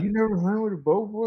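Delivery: a hint of an amazed friend; buddy's voice. Buddy voiced in retold story.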